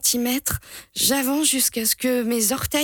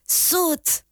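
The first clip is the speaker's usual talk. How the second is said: higher pitched call of "Saute"; called a third time; 8 semitones higher than usual. A narrator's reading. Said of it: "crie mon frère une troisième fois".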